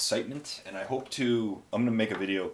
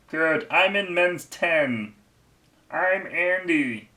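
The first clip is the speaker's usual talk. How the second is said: high, loud, very slow mimicked voice; Andy; 8 semitones higher, 7 dB louder, much slower than usual. Friend introducing himself to a shop clerk, hammed-up impression. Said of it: "here's my impression of Andy".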